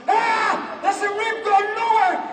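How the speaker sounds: high, loud, drawn-out cry; slow agonised shout; hammed-up pain. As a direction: wail as if injured. Acting out pain, rib gone.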